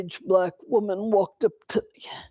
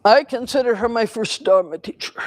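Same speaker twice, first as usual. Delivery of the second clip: ordinary talk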